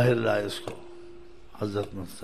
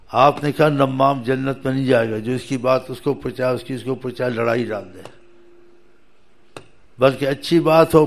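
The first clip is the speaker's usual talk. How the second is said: louder, rising sermon exhortation; fervent preaching; 3 semitones higher, 7 dB louder than usual. Acted. Preacher urges listeners to reconcile, fervent preaching. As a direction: press the call to make peace with force. Preaching against tale-bearing.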